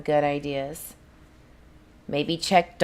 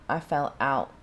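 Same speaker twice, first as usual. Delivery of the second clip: ordinary talk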